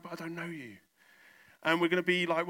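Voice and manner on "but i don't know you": much softer, understated refusal; quiet rejection. Acted. Jesus answering the churchgoer at heaven's door, quiet rejection. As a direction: turn them away softly.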